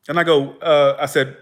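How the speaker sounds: plain reading voice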